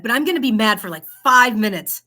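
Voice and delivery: a half-joking huff of anger; mock mad. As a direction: pretend to be peeved. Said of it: "I kinda got mad".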